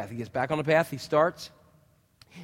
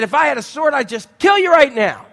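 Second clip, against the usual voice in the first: loud, high furious threat; angry shout; 8 semitones higher, 8 dB louder than usual. Acted. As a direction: shout it like you'd kill him. Balaam threatening the donkey.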